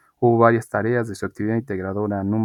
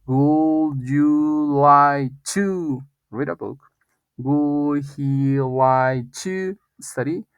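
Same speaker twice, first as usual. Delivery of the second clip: very slow, drawn-out words at a higher pitch; higher-pitched, deliberate teacher demonstration; slow model sentences; 4 semitones higher, much slower than usual. Shown as a demonstration. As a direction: stretch each word out for language learners. Teacher models English example questions.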